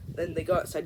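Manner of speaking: ordinary talk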